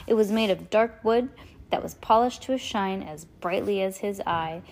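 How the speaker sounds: ordinary delivery, nothing marked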